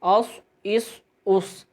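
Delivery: اس with a full-mouth, whistling ص; اس, the ص both heavy and hissing. Shown as a demonstration. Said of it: "منہ بھر کر بھی پڑھا جائے گا اور سیٹی کی آواز سے بھی".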